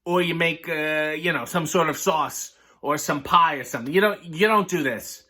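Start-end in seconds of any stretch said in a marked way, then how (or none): none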